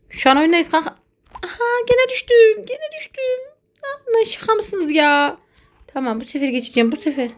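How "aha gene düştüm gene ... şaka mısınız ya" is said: very high, strained disbelief; outraged squeal. Real, not acted. Gamer falling again, high squealing disbelief. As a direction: squeak out the fall in shock.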